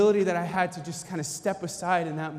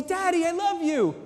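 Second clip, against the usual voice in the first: put-on high sweet child voice, much higher; a little girl gushing at her dad; lightly voiced toddler; daughter's voice; 10 semitones higher than usual.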